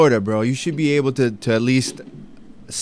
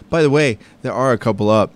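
ordinary delivery

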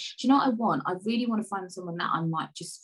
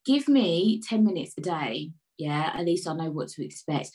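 ordinary talk

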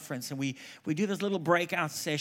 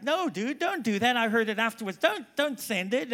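higher, urgent plea; pitched-up mock panic; alarmed protest; 7 semitones higher than usual. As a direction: stop him before he sends it. Retelling his alarmed protest.

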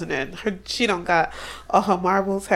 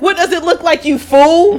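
high, loud snap; mock furious yell; 8 semitones higher, 10 dB louder than usual. Friend voicing Theodora yelling at Eleanor, high and loud.

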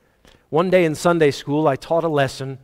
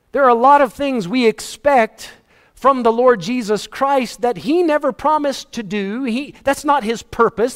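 raised, loud, driving pulpit voice; full-force preaching; 7 semitones higher, 3 dB louder than usual. Acted. Pastor hammering the point home to his church, loud preaching. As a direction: preach it hard to the congregation.

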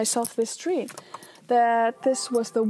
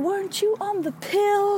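higher mock voice, incredulous; accusing boyfriend; 9 semitones higher than usual. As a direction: mimic his accusing question. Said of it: "And he's like".

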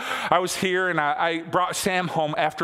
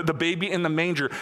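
ordinary talk, nothing marked

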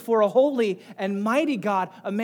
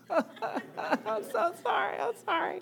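real laugh breaking through, high and slow; laughing sorry to the congregation; laughing apology; 12 semitones higher, 5 dB quieter, slower than usual. Real, not acted. Breaks into laughter mid-sermon.

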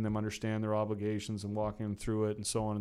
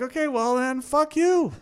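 much higher, louder retold outburst; mock shouted retort; 9 dB louder, slower than usual. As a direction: fling the final insult with a raised voice. Final retort to boss, retold.

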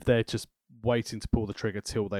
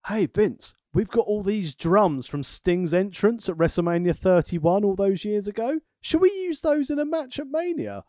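mock pitch to Vince; 6 semitones higher than usual. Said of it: "I was like".

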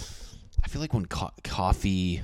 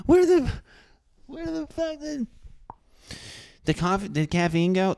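high alarmed squeal; high freaked-out cry; mock panicked surprise. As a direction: panic as if the party just died. Partygoers when coffee leaves.

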